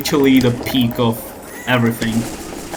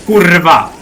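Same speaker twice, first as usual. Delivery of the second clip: Polish exclamation; 3 semitones higher, 4 dB louder than usual. Pretend. Polish swear in game voice.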